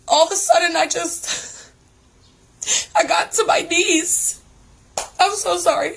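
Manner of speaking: fighting back tears as it comes out; choked-up apology; tearful. Real, not acted. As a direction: voice lifting high, close to tears. Tearful confession of kneeling.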